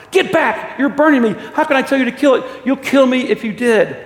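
high, loud, frantic protest; acted ghost pleading in alarm; panicked outcry. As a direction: cry out as if being burned. Ghost screams at burning angel.

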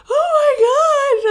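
loud, high, drawn-out wail; hammed-up sobbing cry; retold crying. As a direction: wail it slowly, acting out a breakdown. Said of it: "And I just started crying".

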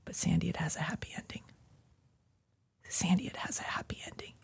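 soft unvoiced whisper, gentle and urgent; whispered inner voice. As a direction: whisper it like a voice from inside. Inner voice's second part.

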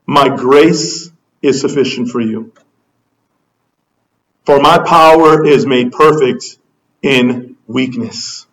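low, measured voice of God; God's simple answer. Acted. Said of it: "And his answer is simple".

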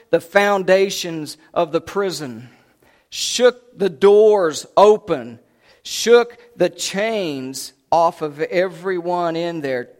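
loud, drawn-out emphatic phrases; forceful preaching. A narrator's reading. Preacher telling of the prison earthquake, forceful preaching. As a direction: preach it big, hammering each phrase.

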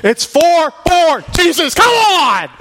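high, loud, slow "come on"; hammed-up annoyed bandmate; mock band exasperation. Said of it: "if Jesus was in a band".

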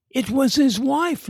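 quick high shout; shouted back. A narrator's reading. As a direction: blurt it back at once. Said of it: "Larry shouted back instantly".

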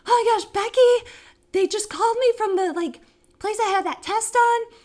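high, loud mock panic; panicked. Retelling the call to friend about the lady, panicked. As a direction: gush it urgently down the phone. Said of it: "call Becky in a panic, being like".

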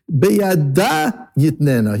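slow, drawn-out "Beyada", then falling "yitnena"; emphasis on beyada. Shown as a demonstration. Teacher showing Hebrew emphasis by word order, stress on "Beyada". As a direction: stretch and lean on "Beyada", let "yitnena" fall. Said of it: "That's how you emphasize in Lashon HaKodesh".

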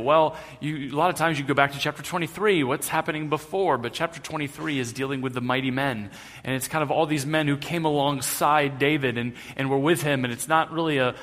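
usual speaking voice

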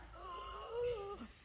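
sharp high gasp of disbelief; high-pitched gasp of shock; excited gasp. Retold or imitated. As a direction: gasp like you can't believe it.

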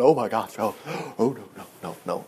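fading, gasping "oh no, no, no"; a gasp, then the panic sinks to nothing; mocking a panicked mourner. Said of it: "nobody panicking going".

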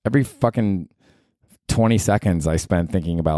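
ordinary talk

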